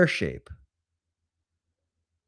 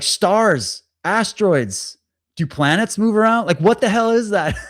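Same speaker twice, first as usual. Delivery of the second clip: excited disbelief; 8 semitones higher, 4 dB louder than usual. Retold or imitated. Urging friend through UFO checklist.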